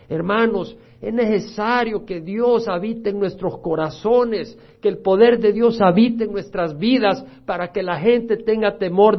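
loud, slowed exhortation; fiery pulpit appeal; full-force preaching. Acted. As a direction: preach it out to the congregation. Exhortation to the congregation.

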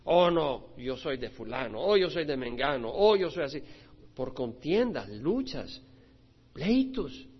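usual speaking voice

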